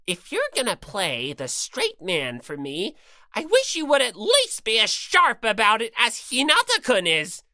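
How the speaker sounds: lightly voiced bossy bear mascot; Monokuma voice. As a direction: lecture her with smug cruelty. Monokuma berating Monomi.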